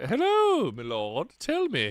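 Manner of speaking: high squeaky "hello", then "my lord"; lightly voiced courtly greeting; mock olde english. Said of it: "Let's hear".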